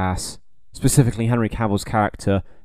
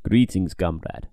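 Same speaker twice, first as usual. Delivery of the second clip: slower, lower mock Russian accent; Russian accent, a slower second attempt; second Russian accent try; 5 semitones lower, slower than usual. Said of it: "I can do a better one".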